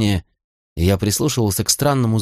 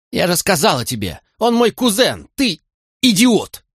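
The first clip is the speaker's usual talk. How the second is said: furious outburst; 10 semitones higher than usual. A narrator's reading. Molly yelling at Fitz in tearful fury. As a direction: hurl the last words in fury. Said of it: "Ярость пылала в ней".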